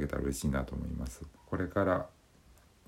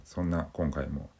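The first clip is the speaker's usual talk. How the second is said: ordinary talk, nothing marked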